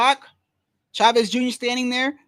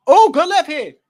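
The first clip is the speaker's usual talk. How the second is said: loud, higher-pitched burst of surprise; excited callout; 6 semitones higher, 10 dB louder than usual. Real, not acted. Streamer reacting to a sudden punch, excited shout.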